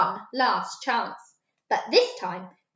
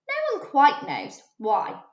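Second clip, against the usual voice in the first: ordinary delivery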